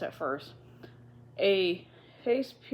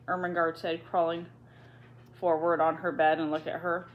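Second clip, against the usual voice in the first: plain reading voice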